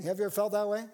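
normal talking voice

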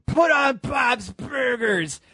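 put-on slow, high, shouted drunk voice; drunken slurring. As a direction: slur it out like you're hammered. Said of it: "drunkenly slurring".

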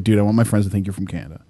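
low, quick, quiet retold request; brother-in-law. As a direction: rattle it off low, like a conspirator. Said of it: "my brother-in-law's like".

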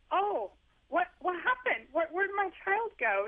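high, bewildered parent, half-joking; mock dismay. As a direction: act stunned that your sweet kid vanished. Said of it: "and then you're like".

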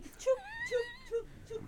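high squeaky mock splashing; imitated splash noise. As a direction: squeak out a silly splash sound. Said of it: "It was like".

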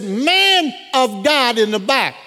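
high, slow, drawn-out call. Retold or imitated. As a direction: summon someone at the back of a hall. Prophet calls to back row.

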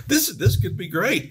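put-on a young engineer lighting up at the news; excited. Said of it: "And I'm like".

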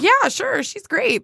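high-pitched, unconvincing cover-up; unfocused fake agreement. Speaker acting out lying to co-host about the Dyson lady, unfocused agreement. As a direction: agree too brightly, as if distracted. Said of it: "Big eyes, not really focused on you".

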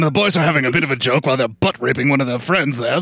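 ordinary delivery

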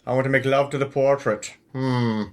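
hammed-up British radio lover; old-timey British accent. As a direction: caricature a stuffy old-time Brit. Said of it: "Old-timey British".

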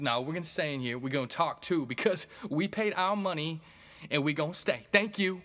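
imitated indignant woman, high and loud; defiant heckler. Heckler's defiant reply acted out.

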